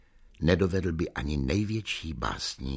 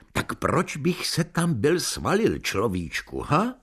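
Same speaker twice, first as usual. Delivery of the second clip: almost angry; 7 semitones higher than usual. Acted. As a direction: snap back at the waiter with rising heat. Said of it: "Zvolal jsem skoro hněvivě".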